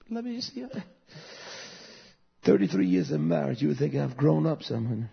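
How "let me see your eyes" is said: imitating wife, softly. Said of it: "That's why I do a stop".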